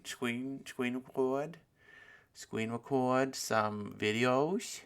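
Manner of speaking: "screen record" with the r's softened to w's; playful mispronunciation. Pretend. Host fumbling "screen record" for listeners, playful mispronunciation. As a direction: say "screen record" like a toddler, stretching it slowly.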